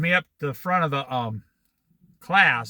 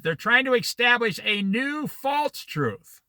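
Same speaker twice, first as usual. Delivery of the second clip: slow, deliberate, every word spelled out; said slowly; 3 semitones higher than usual. Shown as a demonstration. Preacher slows down so the congregation understands. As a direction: lay it out word by word so it sinks in. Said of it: "let me say this slowly so you understand it".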